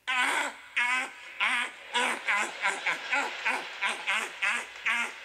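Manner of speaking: mimicked harsh, repeated bark of laughter; Klingon-like laugh. Three guys in the front row, Klingon-like laughing. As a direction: imitate a weird staccato alien laugh. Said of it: "They're laughing like Klingons".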